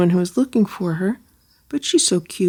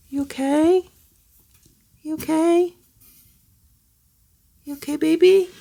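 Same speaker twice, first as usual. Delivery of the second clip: pet talk; 8 semitones higher than usual. Real, not acted.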